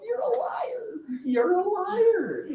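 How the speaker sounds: high, slow singsong accusation; playful sing-song you're a liar; mock accusation. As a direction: drag it out teasing the liar. Calling out lying kid.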